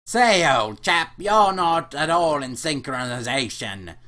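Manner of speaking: put-on drawn-out British old chap; British accent. Clerk telling Elliot he is out of sync, slow British accent.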